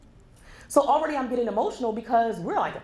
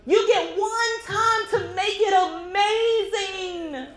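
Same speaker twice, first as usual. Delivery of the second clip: tearful; 10 semitones higher, 3 dB louder, much slower than usual. Real, not acted. Emotional peak about father's death.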